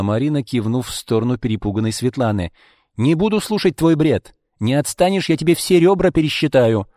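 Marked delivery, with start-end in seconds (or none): 3.0-6.9 loud, higher-pitched warning; acted angry, no-nonsense threat; threatening. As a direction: threaten to beat her up. Friend threatens the aggressor.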